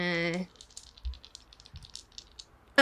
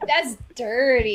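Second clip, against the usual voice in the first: laughing remark; 3 semitones higher, 3 dB louder than usual. Real, not acted.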